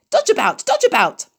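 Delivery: very high, sing-song goose call; honking goose cry. A narrator's reading. Goose urging Wilbur to dodge, high honking cry. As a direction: squawk the orders like a flustered goose. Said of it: "cried the goose".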